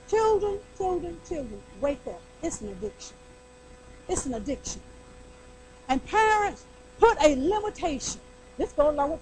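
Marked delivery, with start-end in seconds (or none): 0.1-4.8 soft pleading. Real, not acted.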